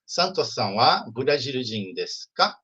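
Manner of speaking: slow demo question, lifting on "ka"; "Santo-san wa Burajil-jin desu ka?" asked slowly, tune rising at "ka"; question intonation. Shown as a demonstration. Teacher models a Japanese question for the class, question intonation. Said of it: "Então, a pergunta é".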